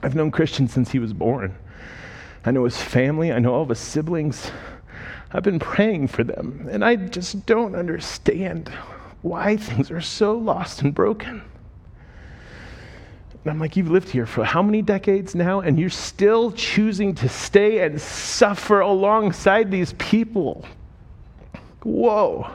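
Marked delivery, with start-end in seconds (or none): 0.0-11.4 retelling her words, near tears; teary. Retold or imitated. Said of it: "kind of teary". 13.8-22.5 choked up with real emotion; emotional. Real, not acted. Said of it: "Sorry, I'm emotional today".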